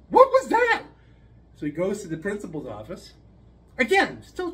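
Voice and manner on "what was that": put-on much higher, louder bewildered child; stunned child. Jimmy reeling after the slap, high child voice. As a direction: squeak it in disbelief.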